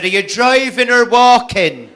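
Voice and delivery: a hint of a helpful Irish policeman; policeman's reply. Irish policeman answers the lost comic: loud, slow, helpful.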